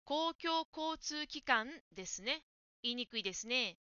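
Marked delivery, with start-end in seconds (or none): 0.0-2.4 公共交通機関 said slowly, every syllable clear; slow model of 公共交通機関; careful model word. Shown as a demonstration. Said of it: "公共交機関と言っていました".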